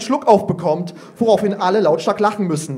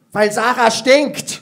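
put-on cheeky child; 4 semitones higher, 3 dB louder, slower than usual. Cheeky pupil answers teacher.

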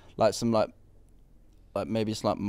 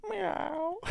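a strained, squeaky attempt at a bleat; failed animal call; 7 dB quieter than usual. Real, not acted. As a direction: try the animal noise and fail. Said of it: "You sound like a dying bird".

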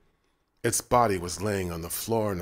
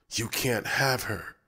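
snarl; 3 semitones higher than usual. A narrator's reading. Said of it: "David snarled".

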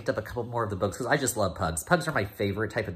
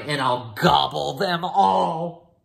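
slow, louder greedy gloat; greedy pug; 5 semitones higher, 7 dB louder, slower than usual. Acted. Pug eyeing the treats, slow greedy gloat. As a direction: gloat over the treats, dragging it out. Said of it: "Those treats will be mine".

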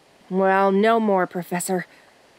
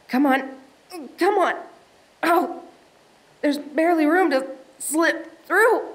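higher, strained voice squeezing through; straining effort; 6 semitones higher than usual. Acted. Explorer squeezing sideways through a crack, straining. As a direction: push the words out while wedged in rock.

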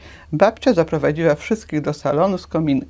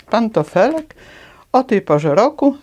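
pantofelek asked high and a bit louder, rising; surprised; 5 semitones higher, 4 dB louder than usual. Acted. Dragon Ludwik surprised at a slipper in the snow, higher and louder. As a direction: sound amazed at a slipper in winter. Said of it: "zdziwił się Ludwik".

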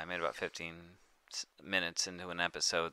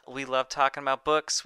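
raised, loud host read with a rising end on books; loud cheery slogan, books rising; 7 semitones higher, 8 dB louder than usual. Acted. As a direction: pitch the slogan upbeat. First take of show slogan.

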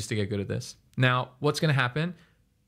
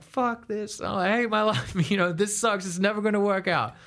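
mock despair; 8 semitones higher than usual. Beginner giving up on guitar.

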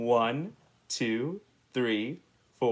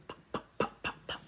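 soft breathy pop sounds; quiet unvoiced popping noises; popcorn pops; 11 dB quieter, much faster than usual. A narrator's reading. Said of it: "popping corn".

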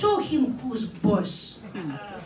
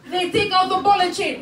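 usual speaking voice

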